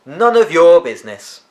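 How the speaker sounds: put-on boy shouting back at a rival. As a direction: snap it back across the garden. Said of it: "Charlie shouted".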